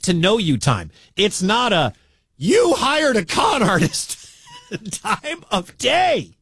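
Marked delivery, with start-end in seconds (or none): 2.4-4.2 slow, high, hammed-up accusing reporter; mock accusation. Host mimicking the columnist's accusation to the team president. 4.6-6.3 slow, high words through a laugh. Real, not acted. Host laughing at the press conference to his producer. Laughing after mock accusation.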